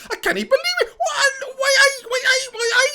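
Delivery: very high, shrieking Geordie cheer; mock Geordie celebration. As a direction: squeal it like an over-the-top Geordie fan. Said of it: "The Geordie Nation celebrate".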